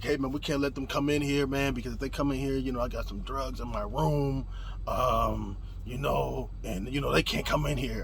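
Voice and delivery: low, soft, quick confiding; confidential aside. Retold or imitated. Roommate pulls him aside about drugs, confidential aside. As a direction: keep it down, explaining privately. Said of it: "pulls me to the side".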